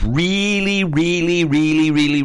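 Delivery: each "really" pitched high and leaned on hard; emphatic really. Real, not acted. Host stresses Brexit's importance to listeners, emphatic. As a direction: hammer home how important it is. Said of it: "I'm emphasizing Brexit".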